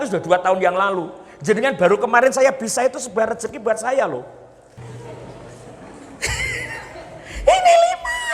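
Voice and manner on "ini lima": squeaky laugh then very high voice; high comic laugh. Acted. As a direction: cackle it in a falsetto.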